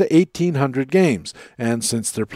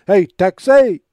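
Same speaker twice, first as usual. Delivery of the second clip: loud, high hey Texas; shouted call; 5 semitones higher, 6 dB louder, slower than usual. Acted. Station ID shout.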